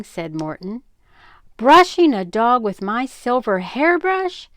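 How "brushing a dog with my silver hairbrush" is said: mock shriek, louder and higher; a half-acted shocked shriek. A narrator's reading. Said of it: "shrieked his mother".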